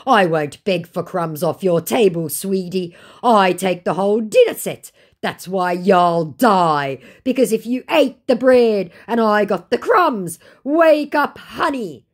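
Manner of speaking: hammed-up scammer brag; mock scammer boast.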